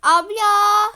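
loud high call across the house. Acted. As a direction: shout for your sister. Calling out to sister. (kız kardeşine yüksek sesle seslenme)

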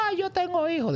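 high, panicked wail of the frightened driver; mock panic, voice shooting way up; scared plea. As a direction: beg like a terrified father. Scared driver begs for his life.

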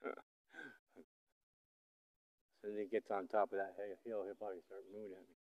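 hushed and soft, half under the breath. Real, not acted. Filmer hiding from the bull, speaking hushed. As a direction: keep it low so the bull won't hear.

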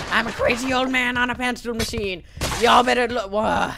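put-on loud, high crazy old-timer; crazy old man. Old man riding a pencil machine, crazy holler.

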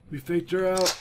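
ordinary delivery

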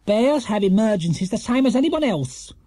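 high, stern rebuke. A narrator's reading. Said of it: "said Missus Bird sternly".

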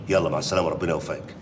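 normal talking voice

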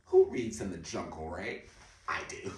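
ordinary delivery

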